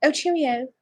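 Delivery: playful British accent. Shown as a demonstration. Finishing the requested accent bit, playful British accent. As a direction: toss it off in a mock British accent. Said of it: "A British one?".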